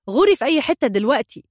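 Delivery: high, loud, quick send-off; angry dismissal. A narrator's reading. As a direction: order someone out of your sight.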